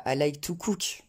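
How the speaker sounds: "cook" with a long French ou, slowly; French-accented mispronunciation. Shown as a demonstration. Teacher showing learners' ou habit, French-accented mistake. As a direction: make "cook" sound like French couque. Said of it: "tendance à prononcer en ou".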